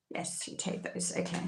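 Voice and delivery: whisper. Real, not acted. Reader answering her own question in a whisper. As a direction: answer herself in a hush. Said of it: "do I take those?".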